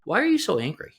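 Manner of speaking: mock wife asking. Wife's retold question.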